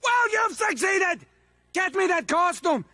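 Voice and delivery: villain snapping at Crash for angering him; angry outburst. Acted. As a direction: explode after holding it in. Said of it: "trying to make me angry".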